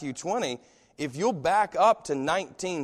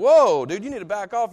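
mock rebuke; 5 semitones higher, 9 dB louder than usual. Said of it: "you're like".

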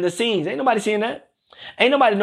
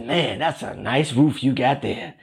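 admiring house guest; 8 semitones lower than usual. Acted. Imagined visitor praising a host's roof, mock-admiring in a low voice. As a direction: play an impressed visitor, pitched well down. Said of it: "No one ever walks up and says".

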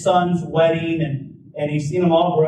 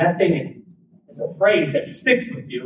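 ordinary talk

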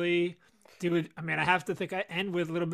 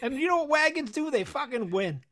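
hyped boast; 8 semitones higher than usual. Real, not acted. Hyped boast about the team.

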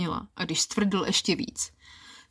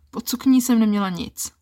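plain reading voice

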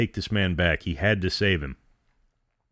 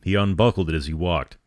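plain reading voice

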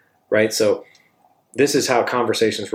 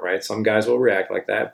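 ordinary talk

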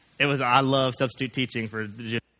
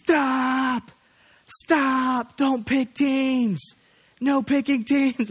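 mom yelling stop; 12 semitones higher, much slower than usual. Retold or imitated. Youth group mom stopping team picking, shrill yell. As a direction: shriek at them to stop, slow and drawn out. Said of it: "she starts going".

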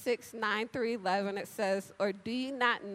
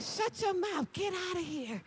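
playful disbelief; 9 semitones higher than usual. Shown as a demonstration. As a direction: drag it out in fun disbelief. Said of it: "So you gotta say, be like".

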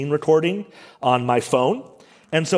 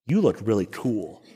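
smug self-talk; 6 semitones lower, 4 dB quieter than usual. Retold or imitated. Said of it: "think to myself".

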